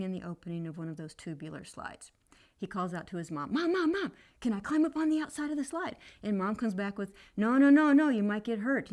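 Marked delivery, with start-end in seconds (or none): 3.5-6.0 put-on high-pitched little boy calling out, louder; child calling mom. 7.4-8.9 loud, higher protective no; mom's loud no. Retold or imitated. Mom refuses, child at risk.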